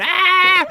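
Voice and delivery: high, drawn-out imitation scream; put-on high "aah" scream; mock scream. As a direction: imitate a character screaming in pain. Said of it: "he's screaming".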